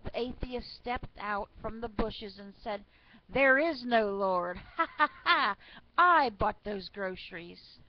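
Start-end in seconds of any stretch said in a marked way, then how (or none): 3.3-7.8 loud acted triumph with a mocking ha-ha-ha; loud gloating. A narrator's reading. Atheist out of the bushes to old lady, gloating. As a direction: crow it loudly, laughing in the middle.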